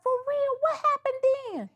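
high, sweet and interested, imitating Carla. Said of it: "Carla be like".

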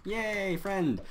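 high happy cheer; delighted cheer. Real, not acted. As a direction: cheer with real joy. Rescued by stranger.

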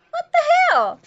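high, loud cry of disbelief; startled outburst. Real, not acted. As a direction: yelp it out, startled mid-game. Startled by something in game.

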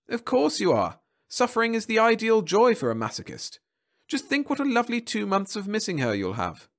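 high, pleased bureaucrat voice; smiling official. A narrator's reading. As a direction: smile through the words.

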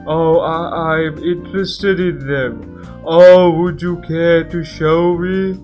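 loud, bright pleading invitation; eager character voice. Acted. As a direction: coax her warmly to come out. Voiced game character asking.

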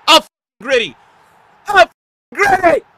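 very high, loud screamed taunt; wild gloating shout; taunting yell. Real, not acted. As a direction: scream it at the opponent. Yelling taunts after scoring.

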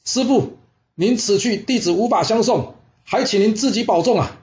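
high-pitched farewell call. Acted. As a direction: call a respectful farewell across the distance, no extra volume. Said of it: "他又大喊了". (高声喊话道别)

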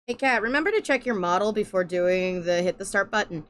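ordinary talk, nothing marked

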